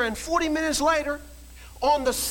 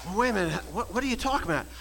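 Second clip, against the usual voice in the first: normal talking voice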